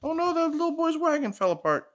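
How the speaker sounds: lively picture-book read-aloud; reading to a child. Acted. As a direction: read it like a bedtime story for a little one.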